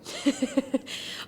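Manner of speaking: short breathy nervous laugh. Real, not acted. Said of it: "I'm laughing 'cause it's a nervous laugh".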